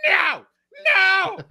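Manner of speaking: very high, loud shriek; screaming lady. Retold or imitated. Lady shrieking as the movie starts, very high and loud. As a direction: scream it like a horror victim. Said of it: "you just hear, like".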